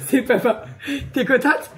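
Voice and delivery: giggling through the words; laughing. Real, not acted. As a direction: laugh while you speak. Laughing while giving gift.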